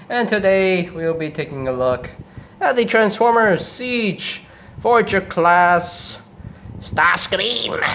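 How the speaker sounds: drawn-out cartoon villain voice; Starscream. Host reviewing Starscream in an imitated villain voice. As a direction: introduce the toy as a theatrical robot villain. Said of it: "I'm trying to sound like Starscream".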